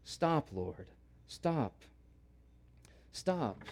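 soft, repeated broken pleas; whisper-soft begging, word by word; pleading softly. Acted. Amos quietly pleading with God to stop, soft repeated begging. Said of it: "We find one word".

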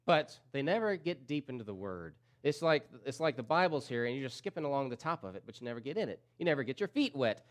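ordinary delivery

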